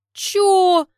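very high, loud, startled shout; jolted into outrage; shocked what. A narrator's reading. Lyudmila shocked by crude remark. (ошарашенный выкрик)

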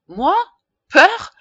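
very high, loud indignant scoff; scoffing disbelief. Acted. Pharmacist scoffing at being called afraid. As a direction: scoff at the very idea. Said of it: "répliqua-t-il en haussant les épaules".

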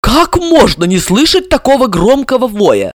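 high, baffled question; bewildered. A narrator's reading. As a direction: ask it at a loss. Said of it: "растерянно спросила Ксения".

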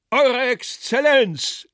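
high, loud, drawn-out heckler's cry; ringing call. A narrator's reading. Guest hails His Excellency from the far end, calling out. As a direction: call slowly across a long table. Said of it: "schallte es nun vom anderen Ende".

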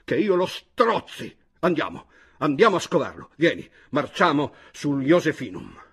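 loud, high rallying cry; shouting. A narrator's reading. Chojnicki urging his friend to hunt one down, shouting. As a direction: shout them into marching off. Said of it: "gridò di nuovo all'improvviso".

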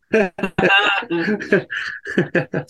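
high burst of laughter; real laughter. Real, not acted. Co-host cracking up at the sister radio plug, real laughter.

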